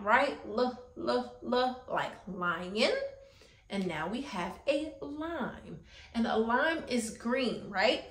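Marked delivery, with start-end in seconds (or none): 0.5-2.9 L, L, L said as clear repeated ell sounds, slow on "L, like lion"; letter sound. Shown as a demonstration. Teacher modelling the L sound for children, slow and clear. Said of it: "an L makes the L sound".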